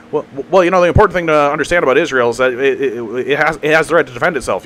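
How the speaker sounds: stammering, rushed babble; fast-talking pundit. Retold or imitated. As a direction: imitate a streamer tripping over rapid talking points. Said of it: "people who talk fast like that".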